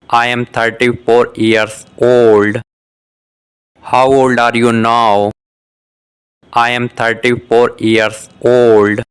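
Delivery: ordinary delivery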